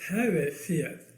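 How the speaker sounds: slow, tongue held down; holding tongue with fingers. Pretend. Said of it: "Hold your tongue and say that".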